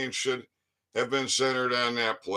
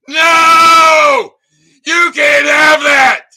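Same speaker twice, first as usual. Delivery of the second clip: high, loud furious ranting that peaks in a scream; triggered rant; 5 semitones higher, 9 dB louder than usual. Real, not acted. Ranting at the governor about lockdown rules, building to a screamed no. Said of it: "yeah, I got triggered".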